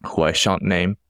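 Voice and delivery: normal talking voice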